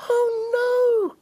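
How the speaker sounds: tiny high worried chick; squeaky little chick, upset; cheeping chick. Acted. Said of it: "cheeped the little chick".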